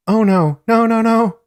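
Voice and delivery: high, loud cries of protest; re-enacted panicked cry; acted scream.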